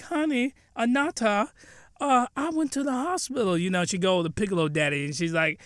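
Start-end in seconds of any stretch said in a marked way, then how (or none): 0.0-3.6 lightly voiced wife, pitched up; a hint of a wife addressing her husband; wife's voice.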